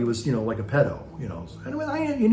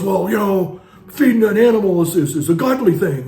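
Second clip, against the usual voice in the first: loud, high self-righteous protest; mocking the driver's indignation, louder and higher; indignant driver; 8 semitones higher, 9 dB louder than usual. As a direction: preach it back like an offended man. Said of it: "And he goes".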